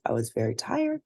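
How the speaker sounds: usual speaking voice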